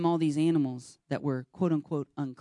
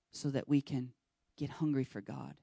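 ordinary talk, nothing marked

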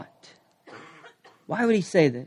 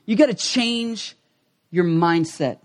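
ordinary talk

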